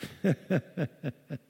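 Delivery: soft amused chuckle; quiet scoffing laugh; God's laugh. Retold or imitated. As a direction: chuckle down from heaven at the world. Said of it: "God's in the heaven looking down".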